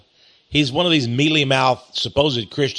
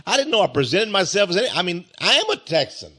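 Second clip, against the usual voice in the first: ordinary delivery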